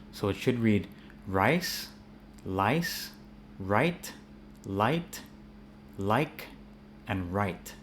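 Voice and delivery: rice, lice, right, light, like said slowly with a clear R and a clear L; clear American R and L. Shown as a demonstration. Teacher reads the word list in his American accent. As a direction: read each word slowly so R and L stay distinct. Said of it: "in my American accent one round".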